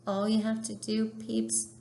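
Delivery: ordinary talk, nothing marked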